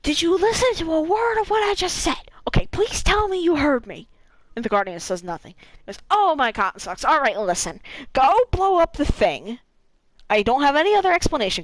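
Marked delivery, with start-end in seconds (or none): 0.0-4.1 lightly voiced, higher exasperated Cade. Cade exasperated at silent Guardian. 6.1-11.6 lightly voiced Cade rushing, a bit louder; hurried Cade.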